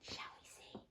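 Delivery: soft conspiratorial whisper; hushed whisper to young listeners. Shown as a demonstration. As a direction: lean in and whisper the question. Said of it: "storyteller whispers to children".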